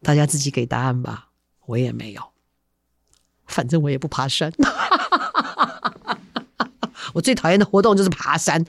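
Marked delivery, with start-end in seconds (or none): 4.6-7.1 long high-pitched giggling laugh; real laugh. Real, not acted. Storyteller admitting they never climb mountains, real high laughter. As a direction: burst out laughing at your own admission. Laughs at own remark on hiking.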